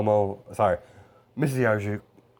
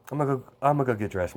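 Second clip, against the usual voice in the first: fast, higher, tumbling flustered words; flustered rush; 5 semitones higher, much faster than usual. Acted. Girl hurrying off to get dressed, flustered rush. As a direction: blurt it out and hurry off embarrassed.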